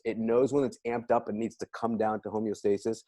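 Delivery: normal talking voice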